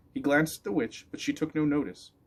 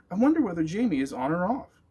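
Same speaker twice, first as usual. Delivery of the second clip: worried dog voice; 8 semitones higher, 5 dB louder than usual. Acted. Dog's worried thought.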